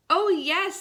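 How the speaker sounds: eager little pig, lightly excited. Acted. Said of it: "said the little pig excitedly".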